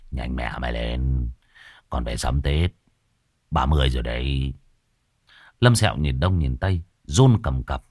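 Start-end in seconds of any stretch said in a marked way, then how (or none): 0.1-4.5 put-on low, rough impatient old man; gruff old sorcerer. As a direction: hurry him along crudely. Gruff old man hurrying someone. (thầy già cục cằn, giọng trầm giục giã)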